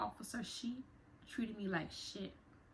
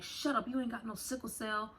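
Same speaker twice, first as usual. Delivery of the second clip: higher-pitched, dismissive officer's snap; retold yelling; 4 semitones higher than usual. Officer disbelieving the detainee's illness, retold yelling. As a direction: imitate an officer cutting someone off. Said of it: "was yelling at me".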